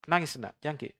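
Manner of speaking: put-on soft, hushed coaxing of a crying child; gently scare the child quiet with a mention of father; mother's soft warning; mother hushing child. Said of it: "Tapi ditakut-takuti saja".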